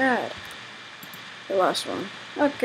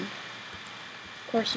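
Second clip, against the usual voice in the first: whispered and much softer; 15 dB quieter than usual. Real, not acted. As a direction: breathe it out under the breath. Repeats of course, then whispers.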